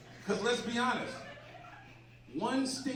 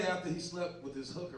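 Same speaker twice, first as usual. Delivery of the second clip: ordinary talk, nothing marked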